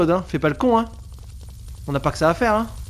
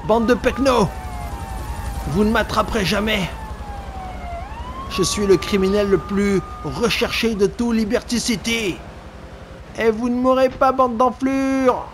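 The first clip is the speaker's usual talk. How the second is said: mock gangster taunt; 8 semitones higher than usual. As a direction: brag at the cops like a cocky crook. Taunting cops as criminal.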